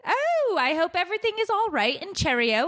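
mock British accent. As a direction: fuss like a British aunt, hammed-up. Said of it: "do a British accent".

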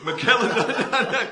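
loud burst of laughter; laughing loudly mid-sentence. Real, not acted.

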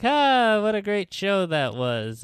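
high, appreciative "Ah" settling down; mock audience delight. Audience getting up after the show, imitated delight. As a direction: imitate a pleased crowd after curtain.

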